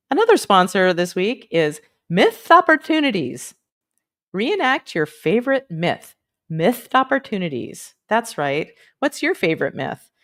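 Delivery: peppy sponsor announcer; punchy, upbeat ad copy; mock ad read. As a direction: sell the silly sponsor with bouncy emphasis.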